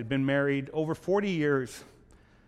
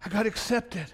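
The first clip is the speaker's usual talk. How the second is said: excited; 7 semitones higher than usual. Acted. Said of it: "He's all excited".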